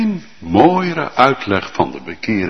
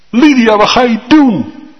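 high, louder alarmed question; alarmed cry; 13 semitones higher, 7 dB louder than usual. Acted. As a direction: cry out at her in dismay. Warning repeated louder. (Lydia, wat ga je doen? luid en ontzet)

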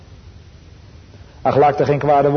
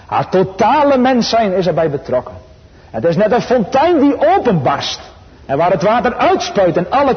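rising, much higher and louder, full pulpit force; preacher in full flight, voice soaring with fervour; impassioned preaching; 7 semitones higher, 4 dB louder than usual. Acted. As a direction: let it burst out like a fountain of praise. Preaching climax, fountain image.

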